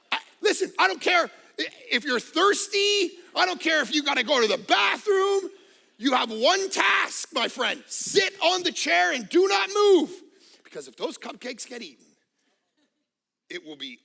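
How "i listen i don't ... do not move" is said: wife shouting orders. Retold or imitated. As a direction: drill it into him like a sergeant. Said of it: "my wife's like".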